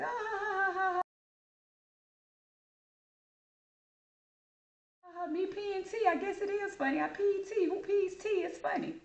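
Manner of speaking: put-on high, giggling kid's voice; laughing kid.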